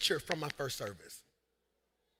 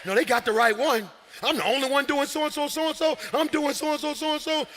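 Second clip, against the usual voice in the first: mock cussing rant; 7 semitones higher, 7 dB louder than usual. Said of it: "You cussing right now".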